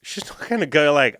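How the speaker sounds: ordinary talk, nothing marked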